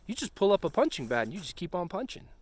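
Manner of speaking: normal talking voice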